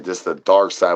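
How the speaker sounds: usual speaking voice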